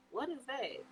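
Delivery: retold hushed, puzzled question; quiet puzzlement. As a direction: ask it very softly, acting baffled. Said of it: "And I was like".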